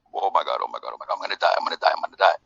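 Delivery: low, quiet, rapid mock panic about dying. Guest retelling his helicopter panic, mock terrified. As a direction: replay the hungover terror in the helicopter.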